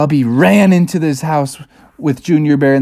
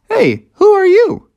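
put-on high, raised child voices; kids challenging a stranger; 5 dB louder than usual. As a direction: demand an answer in a high voice.

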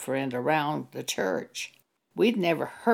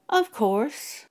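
a touch of smug certainty, slightly higher; smug matter-of-fact; 5 semitones higher than usual. Retold or imitated. The church teacher answering the question, mildly smug. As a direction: toss it off as if it's obvious. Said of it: "in that tone of voice".

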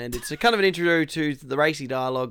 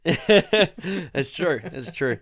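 laughing; 3 semitones higher, 4 dB louder, much slower than usual. Real, not acted. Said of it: "trying not to laugh".

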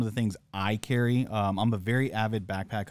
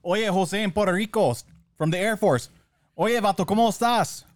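high, loud hammed-up Mexican accent; exaggerated Mexican homeboy accent, pitched up; 10 semitones higher, 5 dB louder than usual. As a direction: play up a jokey Mexican accent, calling out. Teasing hammed-up Mexican accent bit.

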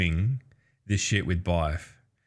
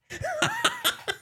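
high burst of laughter; 3 dB quieter than usual. Real, not acted. Laughs at own boast.

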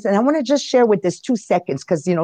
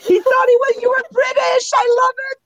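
very high, hammed-up delighted squeal; tickled, far up in falsetto pitch; playful squeal; faster than usual. Squealing delight at being thought British.

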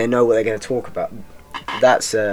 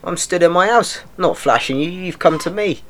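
loud, raised-pitch telling-off at the door; indignant rant; 7 semitones higher, 5 dB louder than usual. Retold or imitated. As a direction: tell them off, you came to me. Ranting at trick-or-treaters at door.

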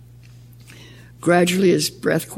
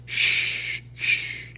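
soft whispered shushing father; soothing hush; 10 dB quieter than usual. Acted. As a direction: whisper to calm a sobbing child. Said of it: "patting his head".

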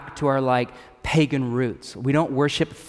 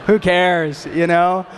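louder, defiant who-cares tone; punk rock defiance; 5 semitones higher, 5 dB louder, slower than usual. Retold or imitated. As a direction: toss it off like a punk rocker. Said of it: "it's so punk rock".

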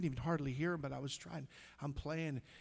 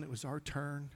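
ordinary talk

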